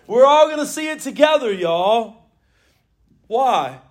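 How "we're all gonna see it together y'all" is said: Southern preacher call, coming down; Southern flourish. Acted. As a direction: hit it Southern again, settling down. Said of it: "in the South".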